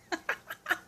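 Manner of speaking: short breathy gasps; panting gasps, acted out; imitated hyperventilating. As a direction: mimic quick hyperventilating puffs. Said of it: "I was, like, hyperventilating".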